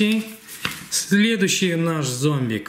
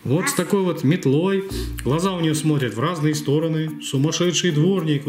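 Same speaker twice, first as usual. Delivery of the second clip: ordinary talk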